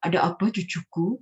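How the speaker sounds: lightly voiced grandma speaking to a grandchild; grandmother character. Shown as a demonstration. Grandma answers grandchild on stage, grandmother character. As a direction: play a grandmother addressing her grandchild. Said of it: "kiri, ganti suara".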